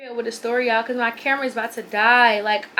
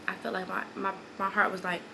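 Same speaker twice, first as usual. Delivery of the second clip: usual speaking voice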